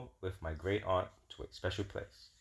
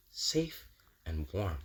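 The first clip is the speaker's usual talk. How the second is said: safe and warm; 2 semitones lower, 5 dB quieter, much slower than usual. Acted. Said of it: "The flag made me feel like this".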